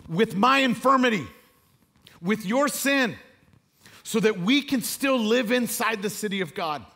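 loud, high, ringing preacher's build; impassioned sermon climax; full-force preaching. Acted. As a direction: preach it out to the whole room, rising.

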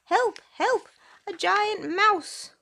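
high, loud squeaky cry for help; a tiny frightened mouse squeaks; squeaking mouse. Acted. Mouse waking Percy, high loud squeaky panic. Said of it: "he squeaked".

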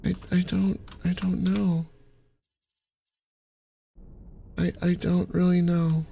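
soft, defeated stammer; small, deflated voice; quiet resignation. Real, not acted.

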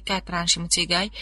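ordinary delivery

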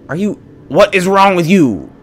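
loud, high-pitched outrage; real angry shouting. Real, not acted. Gamer yelling at people who bust up the car, outraged. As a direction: shout in exasperated disbelief at reckless drivers. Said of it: "I'm so mad".

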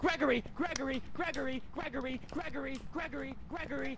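high-pitched name called over and over; repeated calling. Pretend. Player summons the ghost Gregory, repeated high calling. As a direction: pester the ghost in a high, identical falling call.

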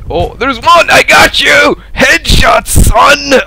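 high, loud gloating yell; triumphant shout. Real, not acted. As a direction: shout it high in the thrill of a kill. Spots and kills an enemy.

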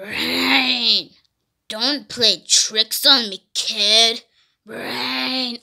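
low, slow zombie drone; play zombie voice. Pretend. Zombie talks to the child.

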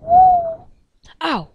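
sudden high loud yelp; shocked yelp. Real, not acted. Player suddenly attacked, yelping in shock. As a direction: yelp as if hit out of nowhere. Hit by something in game.

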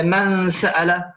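من سأل with the ghunna of the n held slowly toward the s; slow drawn-out nasal hum; nasal hiding. Shown as a demonstration. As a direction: let the nun in من hum slowly into the س. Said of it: "كقولنا مثلًا".

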